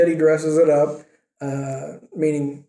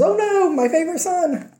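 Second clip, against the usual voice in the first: much higher, hammed-up worried mother; fretting mother; 12 semitones higher than usual. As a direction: squeal it like a doting, alarmed mom. Rebecca voiced in the story.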